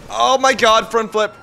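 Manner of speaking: high, loud cry at a stunt; excited yell. Real, not acted. Driver mid-chase as the car flips, excited yell. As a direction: yell out the flip as it happens.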